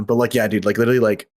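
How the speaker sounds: usual speaking voice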